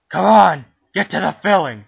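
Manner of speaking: loud, high, impatient yelling; impatient shout. Real, not acted. Teen filming a cooking video, shouting impatiently. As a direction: yell for them to hurry up.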